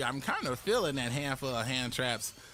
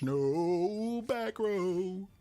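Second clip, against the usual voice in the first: preaching to church; 9 semitones higher, much slower than usual. Acted. Streamer leading chat as church, slow high sermon. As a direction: preach it slowly to the congregation. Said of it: "Say it with me, church".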